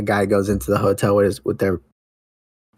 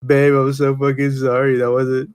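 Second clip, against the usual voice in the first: pitched-up, half-hearted tearful apology; mock crying; 4 semitones higher than usual. Comic guy apologizing to his babe, mock crying. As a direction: plead through badly faked tears. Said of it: "crying. He's all like".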